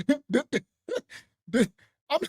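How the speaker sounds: ordinary talk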